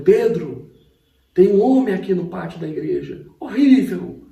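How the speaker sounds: high, loud calling out to the pastor; alarmed call. Retold or imitated. Sister calls the pastor about a scary man, loud alarmed. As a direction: sound the alarm about a stranger outside.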